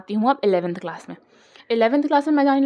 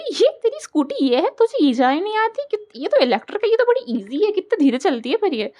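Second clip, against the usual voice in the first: high mocking friend's voice; friend's taunt; 8 semitones higher, 3 dB louder than usual. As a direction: look down on the scooter smugly. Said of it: "तो B was like". (मज़ाक उड़ाती दोस्त)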